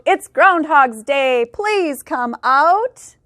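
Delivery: slow, drawn-out calling of the townsfolk; mild crowd shout. Acted. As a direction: call him out slowly like a whole crowd. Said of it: "said with a shout".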